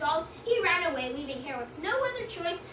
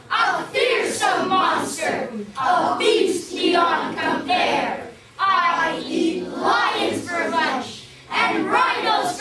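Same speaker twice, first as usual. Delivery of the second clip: slow, very loud and higher roaring monster chant; the monster roars its chant at Leopard, slow shouted boast; fearsome monster shout; 3 semitones higher, 10 dB louder, much slower than usual. Acted. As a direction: bellow it to scare the leopard off.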